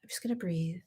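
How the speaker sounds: soft whispered mantra to herself; hushed, calming herself; whispered self-calming. Retold or imitated. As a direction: whisper it to calm yourself down. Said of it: "I was just like".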